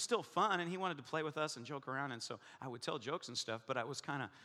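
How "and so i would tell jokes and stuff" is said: ordinary talk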